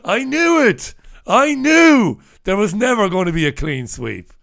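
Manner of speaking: loud, high burst of exasperation; triumphant outcry. Real, not acted. Host crying out as the clean sweep fails, exasperated outcry.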